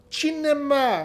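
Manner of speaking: drawing out "Cinema." as a correction; correcting pronunciation. Shown as a demonstration. Repeating "Cinema." the right way for a friend.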